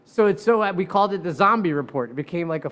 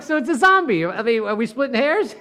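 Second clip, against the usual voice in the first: excited cross-examining; 5 semitones higher, 3 dB louder than usual. Retold or imitated. Lawyer pressing the witness.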